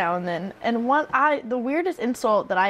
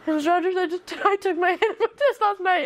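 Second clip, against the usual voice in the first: put-on higher, laughing kid voice; student quote; 7 semitones higher than usual. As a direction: quote the boy through a laugh. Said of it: "he said to me".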